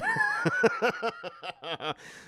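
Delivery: very high, drawn-out laugh; high-pitched laugh. Real, not acted. Streamer cracking up at their own gun plea, high laugh.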